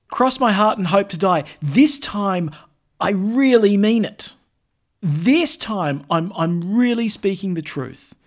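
earnest oath-swearing. Retold or imitated. Voicing someone swearing an oath.

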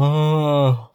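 long drawn-out yawning "uh"; mimed yawn. Acted. Mom coming out in the morning, acted yawn. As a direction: fake a sleepy morning yawn. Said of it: "doing that yawning thing".

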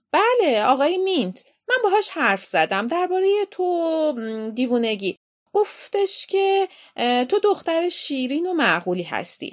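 high, loud aunt voice; brisk aunt. Acted. Aunt passing on headmaster's praise to Lizzie, emphatic aunt voice. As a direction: talk at the child emphatically.

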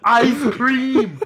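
high loud goofy yell with a laugh; dopey shout, laughing through it; Forrest Gump. Retold or imitated. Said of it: "I'm talking like Forrest Gump".

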